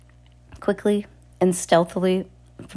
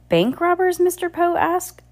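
very high, alarmed question; startled question; 13 semitones higher, 3 dB louder than usual. A narrator's reading. Mister Poe asks about bank robbers, startled high voice. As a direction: ask it in high-pitched disbelief.

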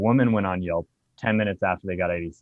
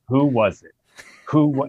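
put-on drunk demand; 5 semitones higher, 6 dB louder than usual. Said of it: "I was intoxicated".

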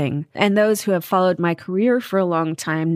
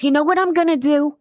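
put-on a light New York mom accent, pitched up; a hint of a New York mom, raised pitch; mom's New York accent; 9 semitones higher, faster than usual. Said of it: "she has this N-, New York accent still".

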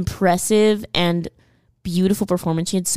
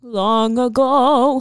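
slow, drawn-out, higher; mock storyteller; 4 semitones higher, much slower than usual. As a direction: stretch it out like a fairy tale. Dramatic echo of long ago.

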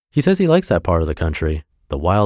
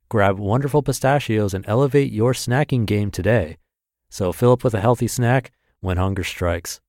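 ad read; 4 semitones higher than usual. Acted. Sponsor ad read.

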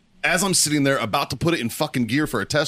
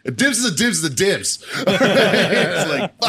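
laughing; 7 semitones higher, 4 dB louder, slower than usual. Real, not acted. Laughing while ranting about dibs.

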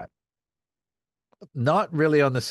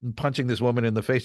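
ordinary talk, nothing marked

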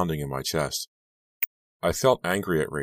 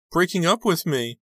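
high, loud, tearful wail; sobbing; 14 semitones higher, 5 dB louder than usual. A narrator's reading. Rachel telling her friend of the breakup, sobbing. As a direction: wail it high like an upset girl. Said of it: "she started to sob again".

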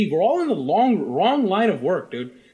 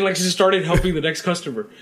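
ordinary delivery

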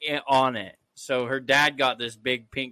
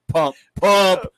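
heartbeat imitation; 3 semitones higher, 9 dB louder than usual. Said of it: "It was like".

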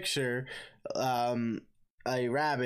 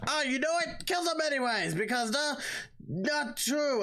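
much higher, hammed-up Pope; 14 semitones higher than usual. Pope orders the boys killed anyway, hammed-up high voice. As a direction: camp up the Pope ordering a killing.

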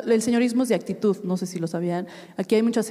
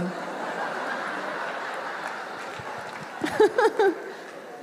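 long high unvoiced laugh; long airy real laugh; breathy laugh; 10 semitones higher, 6 dB quieter than usual. Real, not acted. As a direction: let the laugh run on. Laughs after anecdote.

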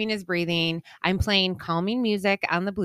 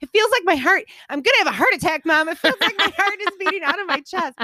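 put-on high, fast, breathless child's panic; panicked kid; 9 semitones higher, 4 dB louder, faster than usual. Mom imitating her caffeinated son's panic, high-pitched. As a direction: voice the boy sure his heart will burst. Son panicking about heart, voiced.

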